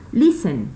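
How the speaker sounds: listen, LIS high and strong, then falling; stress on first syllable. Shown as a demonstration. Teacher giving students a counter-example, first-syllable stress. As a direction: punch the start of listen. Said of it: "fuerza de voz en la primera sílaba".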